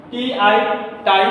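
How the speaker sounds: ordinary talk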